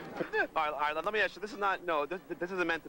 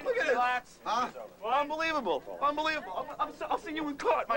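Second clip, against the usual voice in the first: outraged shouting; 8 semitones higher than usual. Real, not acted. Outraged after being shoved.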